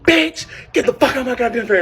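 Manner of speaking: high, loud furious outburst; snapping back. Retold or imitated. Daughter snapping at mother in her face, loud and furious. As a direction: finally snap and yell her out. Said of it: "I just snapped. I was like".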